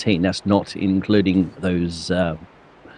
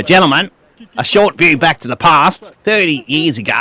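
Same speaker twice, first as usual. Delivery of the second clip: high, broad Aussie demonstration; Australian accent; 6 semitones higher, 3 dB louder than usual. Shown as a demonstration. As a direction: show how an Aussie would announce it. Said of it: "in an Australian accent".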